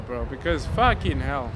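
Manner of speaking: ordinary talk, nothing marked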